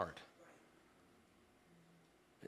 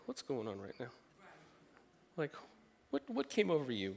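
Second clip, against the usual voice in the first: puzzled parent; 3 semitones lower, 8 dB quieter than usual. Retold or imitated.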